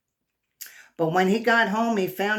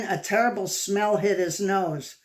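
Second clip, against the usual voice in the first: ordinary delivery, nothing marked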